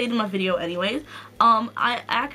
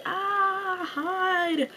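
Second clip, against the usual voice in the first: high, soft pretend cry of alarm; mock panic; 9 semitones higher, 3 dB quieter than usual. Imagining farm gangs under milk fire, high mock panic. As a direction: play-act ducking from an attack.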